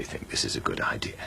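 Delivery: urgent whisper. Acted. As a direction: hush it under your breath. Whispered exchange at the club.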